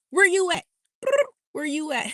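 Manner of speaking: high walkie-talkie holler, fading on the repeat; hammed-up radio call; chirp phone call. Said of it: "remember those chirp phones?".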